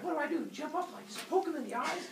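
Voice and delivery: high-pitched clueless helper; high cartoonish questioning voice; eager Kronos. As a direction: pitch it way up, like a keen but naive sidekick. Kronos asks for his instructions.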